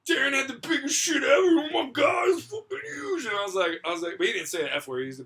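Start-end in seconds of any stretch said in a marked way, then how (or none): 0.1-3.2 high, loud shocked yelling; shocked shout. Retold or imitated. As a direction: scream it in disbelief, drawn out. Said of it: "Ken goes".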